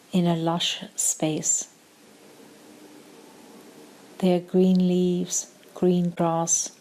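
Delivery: calm meditation voice with long pauses; short, measured phrases, leaving room to breathe; guided meditation. Acted. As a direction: guide them gently into stillness, unhurried.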